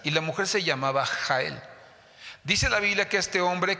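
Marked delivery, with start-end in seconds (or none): none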